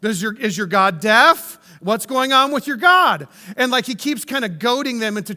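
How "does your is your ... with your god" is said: loud mocking call-out with raised pitch peaks; needling, hollered taunts; taunting. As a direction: goad them with loud, teasing questions. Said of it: "He's, like, taunting them".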